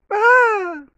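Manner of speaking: very high, loud shriek; high-pitched girly scream. Retold or imitated. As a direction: squeal like a frightened girl. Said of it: "in a high-pitched girl voice".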